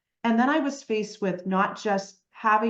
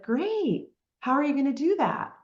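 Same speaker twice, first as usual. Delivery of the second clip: higher-pitched imitation of sceptical parents; parents' dubious reply; 6 semitones higher than usual. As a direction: act out parents questioning the plan, pitched up. Parents' reaction acted in a story.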